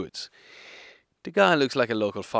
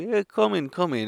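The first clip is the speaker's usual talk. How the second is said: welcoming host; 9 semitones higher, 4 dB louder than usual. Retold or imitated. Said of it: "And he's like".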